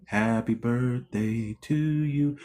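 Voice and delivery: a hint of a sugary teacher crooning; sweet teacher voice. Vlogger imitating the teacher singing to the kid, mildly sweet. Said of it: "See how sweet that lady sounded?".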